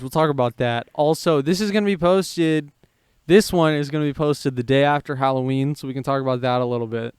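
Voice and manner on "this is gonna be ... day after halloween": ordinary talk, nothing marked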